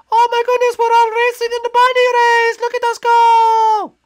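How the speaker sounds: very high, loud bunny squeal; excited squeaky bunny. Acted. Bunny racing with the others, squealing excitedly. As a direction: squeal with delight, stretching the last word. Bunny cheers during race.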